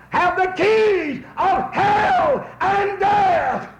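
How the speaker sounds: very slow, high, loud declaration; preached shout. Acted. Preacher thundering Revelation to the congregation, slow and loud. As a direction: hammer it home.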